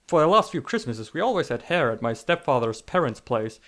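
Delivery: ordinary talk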